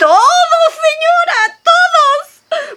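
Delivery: high, loud wailing emphasis; high exclamation. Acted. Maid tells mistress the neighbours heard, high wailing exclamation. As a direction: cry it out, voice leaping way up.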